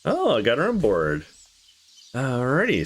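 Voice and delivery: ordinary talk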